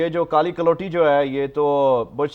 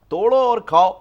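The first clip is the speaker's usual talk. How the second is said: raised, higher exasperated call; loud retort; 7 semitones higher, 7 dB louder than usual. Acted. Dr Shah scolding Khalid over apples, loud retort. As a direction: bark it at Khalid in disbelief. Arguing about buying apples.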